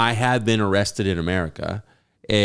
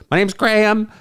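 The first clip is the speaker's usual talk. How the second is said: high, eager fan voice; imitating himself; 11 semitones higher than usual. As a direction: introduce yourself like a starstruck kid. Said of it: "I was like".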